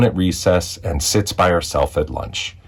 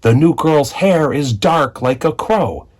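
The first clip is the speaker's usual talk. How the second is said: taunting shout; 7 semitones higher than usual. A narrator's reading. Bully Nathan shouting at the new girl, taunting. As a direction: jeer it across the playground.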